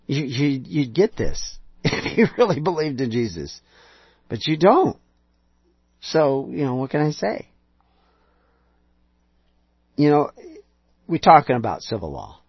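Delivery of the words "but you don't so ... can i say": ordinary delivery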